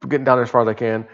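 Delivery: usual speaking voice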